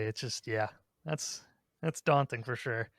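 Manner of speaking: usual speaking voice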